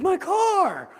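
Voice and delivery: a high, loud cry over a dented car; high shocked scream of "My car!"; dismayed shout. Retold or imitated. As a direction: yell it like your new car just got hit. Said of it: "He's like".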